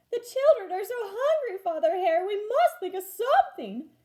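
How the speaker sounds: high, loud pleading mother hare; Missus Hare, pleading. A narrator's reading. Worried mother hare pleads with husband, high and loud. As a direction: beg Father Hare for the hungry children. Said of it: "Missus Hare cried one day".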